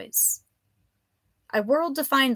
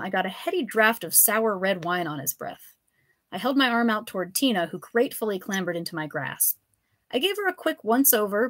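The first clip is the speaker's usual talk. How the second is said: ordinary delivery, nothing marked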